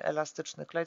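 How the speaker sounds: normal talking voice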